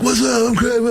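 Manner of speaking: high-pitched, hyped stage greeting; high energy. Retold or imitated. Opener greeting the crowd, mock high energy. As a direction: burst out like an overeager opening act. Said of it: "I do my high energy".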